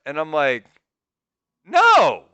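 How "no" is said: loud, high-pitched mock-indignant no; emphatic refusal. Man rebuffing an older woman's advance, emphatic refusal. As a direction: act out a firm, loud refusal.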